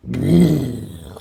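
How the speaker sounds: car exhaust rumble made with the mouth; imitated loud exhaust pipe. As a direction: imitate a revving tailpipe. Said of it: "I hear this loud pipe".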